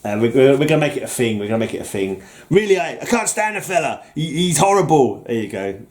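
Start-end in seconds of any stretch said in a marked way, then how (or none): 2.5-5.2 higher-pitched mock outrage, badly faked; put-on loathing. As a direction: play-act disgust at a rival. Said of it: "That was believable".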